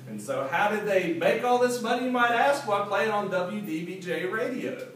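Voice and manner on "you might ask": ordinary delivery, nothing marked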